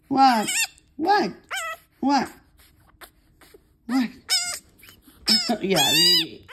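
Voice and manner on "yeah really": high squealing laugh through the words. Real, not acted. Laughs at the yelling kitten.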